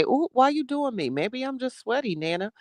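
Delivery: put-on whiny child protest. Teasing her nana in a high childish whine. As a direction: pitch it way up like a kid caught out. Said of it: "I say".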